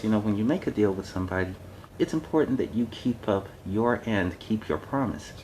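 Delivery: usual speaking voice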